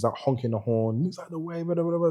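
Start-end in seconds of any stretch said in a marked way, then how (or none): 1.0-1.6 high, quiet mock rant; swearing Italian driver. Annoyed Italian driver swearing at the car blocking him, imitated high and soft. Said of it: "this Italian guy is driving". 1.6-2.1 high-pitched babble for a rant; mock swearing. Italian driver honking and cursing in traffic, mock high babble.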